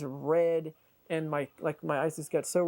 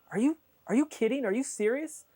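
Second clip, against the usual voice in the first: incredulous; 9 semitones higher, 4 dB louder than usual. Retold or imitated. Retelling the guy's disbelief.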